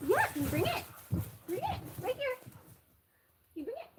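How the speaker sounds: high, soft coaxing pet voice. Real, not acted. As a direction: coo at the puppy to fetch. Talking to dog playing fetch.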